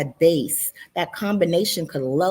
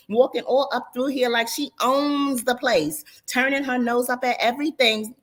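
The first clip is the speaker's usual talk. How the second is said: ordinary delivery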